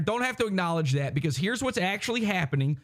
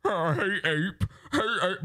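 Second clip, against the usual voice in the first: lightly mocking impression of a rival's imitation; mock his attempt at mimicking you; 2 semitones higher than usual. Host mimics a rival trying to do him, mock impression. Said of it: "whenever he tries to do me".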